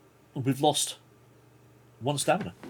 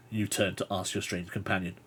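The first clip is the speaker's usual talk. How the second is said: usual speaking voice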